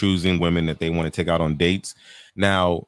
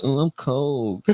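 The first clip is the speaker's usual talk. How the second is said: mock coy girl; 5 semitones higher, 4 dB quieter, much slower than usual.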